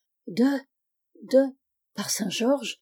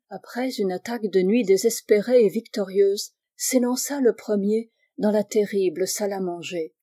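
usual speaking voice

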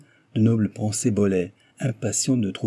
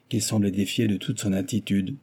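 ordinary delivery

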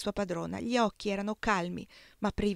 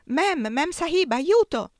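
high, loud calls rising to a scream; shrill cries for help; 8 semitones higher, 9 dB louder, slower than usual. A narrator's reading. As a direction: cry out for help in panic. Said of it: "fu svegliata da grida acute".

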